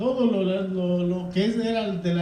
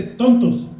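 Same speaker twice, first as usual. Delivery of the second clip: sharp rebuke; 4 semitones higher, 6 dB louder than usual. Retold or imitated.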